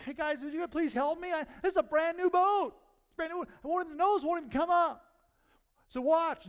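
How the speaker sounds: high, loud pleading woman's voice; voicing a flustered woman asking for help. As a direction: beg the guys for help, voice pitched high. Woman asks guys for help.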